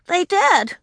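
put-on high, insistent little girl; a little girl who must be believed; insisting. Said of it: "Ramona insisted".

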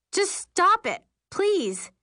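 high, urgent begging; frightened plea. A narrator's reading. Dizzy passenger begging sister, frightened. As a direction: beg her to stop, pitched high.

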